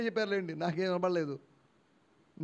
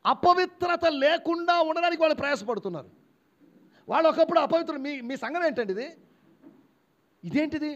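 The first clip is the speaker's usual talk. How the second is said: raised, high-pitched preacher's voice; forceful preaching; 8 semitones higher, 5 dB louder than usual. Real, not acted. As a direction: press it on the congregation, loud and high.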